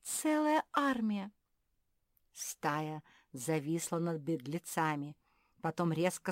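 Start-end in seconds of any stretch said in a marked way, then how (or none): 0.0-1.3 high, slow wonder; awed, drawn-out exclamation; gasping amazement. A narrator's reading. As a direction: marvel at the sight. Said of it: "ахнула Мафи".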